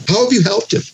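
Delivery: high-pitched mock admiration; sarcastic. Job to his unhelpful friend, bitterly sarcastic. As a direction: throw the question out with mocking praise. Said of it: "That's sarcasm".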